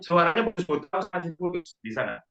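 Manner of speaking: choppy broken-up call audio; glitching robot. Real, not acted. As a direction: voice cutting in and out. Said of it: "terputus-putus suaranya".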